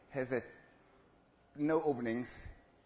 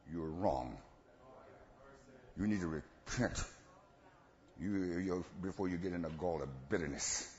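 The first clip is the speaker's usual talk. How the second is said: lower, soft scolding voice; a hint of a stern rebuke; 11 semitones lower, 7 dB quieter than usual. Preacher voices the rebuke to the partner, lower and softer. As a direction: lightly act a rebuke. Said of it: "you become a villain".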